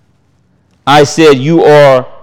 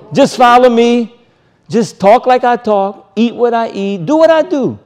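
rising urgency; 6 semitones higher than usual. Real, not acted. Preacher paraphrasing Yeshua's call.

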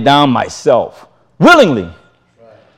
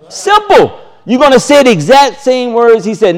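higher, building preacher cadence; preaching at full force; 6 semitones higher than usual. Real, not acted. Preacher to congregation, hammering what following Yeshua means. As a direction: preach it, building toward the peak.

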